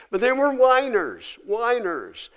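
a hint of a petulant child's whine; mock whiny complainers. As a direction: lightly whine it like the grumbling Israelites. Preacher mocking the whining people.

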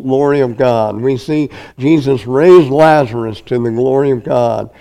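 ordinary delivery

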